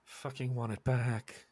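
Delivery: slow, soft aggrieved growing demand; aggrieved dead man. Acted. Dead Wilhelm wanting his head back, slow quiet demand.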